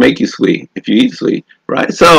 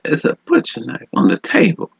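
quiet retold quote; 5 semitones higher, 7 dB quieter than usual. Young woman's story voiced quietly.

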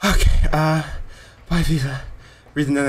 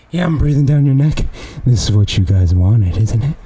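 loud taunt; 4 semitones lower, 6 dB louder than usual. Acted. Taunting chat after a challenge.

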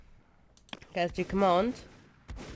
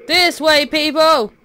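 loud, raised call to followers; 5 semitones higher, 7 dB louder than usual. Pretend. Leader to straggling warriors in a game, calling out. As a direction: call the group over, high and loud.

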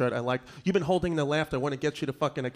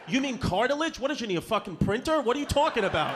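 high, incredulous questioning; high-pitched baffled son; exasperated correction; 8 semitones higher, faster than usual. Acted. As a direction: correct him in exasperated disbelief.

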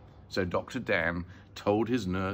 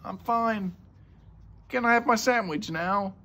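put-on high-pitched boy voice; much higher, childlike answer; boy's voice; 11 semitones higher, 4 dB louder than usual. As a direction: pipe up like a child, carefree. Boy answers from inside the box.

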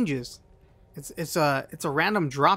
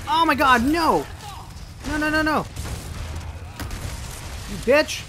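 high panicked shouts at the game; frantic, cursing the enemy; panicked yelling; 8 semitones higher than usual. Real, not acted.

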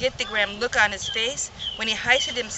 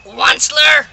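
loud croaking call; loud cry with a croak; 4 semitones higher, 11 dB louder than usual. Acted. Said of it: "he cried with a cruffulous croak".

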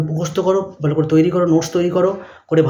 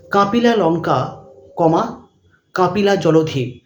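dictating; much slower than usual. Shown as a demonstration. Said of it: "পরের প্রশ্ন লিখবে".

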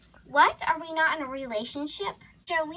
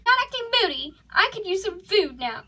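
higher, indignant denial; moody protest; 6 semitones higher than usual. Acted. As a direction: protest moodily that you are not moody. You are both acting very moody.

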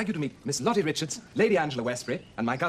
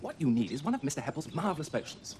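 normal talking voice

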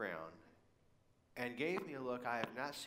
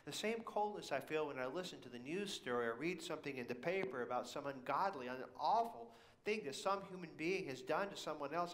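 normal talking voice

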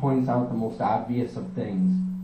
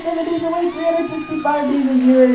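high, loud, racing rant; manic rant; 4 dB louder than usual. Acted. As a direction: wind yourself up into a frenzy. Ranting in character about ADHD.

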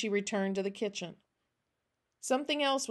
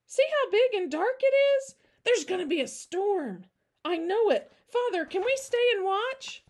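put-on very high, loud child voice; squealing, eager child pleading to stay; thrilled little girl; 12 semitones higher, 6 dB louder than usual. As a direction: gush with excitement at a coming storm.

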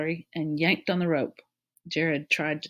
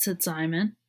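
usual speaking voice

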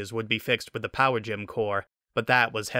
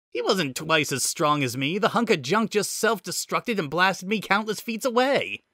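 higher-pitched indignant protest; defensive; 8 semitones higher than usual. A narrator's reading. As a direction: defend yourself, voice climbing as you go. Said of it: "Shard defended".

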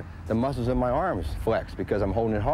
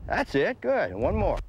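cheering praise; 7 semitones higher than usual. Real, not acted. Coach cheering a bunt.

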